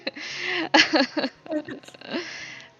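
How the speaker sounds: high, loud burst of laughter; real, sustained laughter. Real, not acted. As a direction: crack up at your own joke. GM laughing at own joke.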